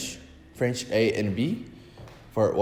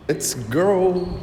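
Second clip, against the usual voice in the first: excited call; 8 semitones higher than usual. Real, not acted. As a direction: call out eagerly to follow. Repeats let's go, second louder.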